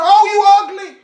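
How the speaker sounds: high gloating parrot jeer. Acted. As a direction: relish the insult like a parrot. Parrot voiced in a joke.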